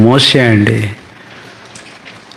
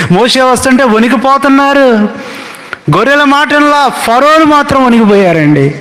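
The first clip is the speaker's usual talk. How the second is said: fervent preaching; 11 semitones higher than usual. Acted. Preacher telling the church how Pharaoh trembled, fervent. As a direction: preach it with rising fire.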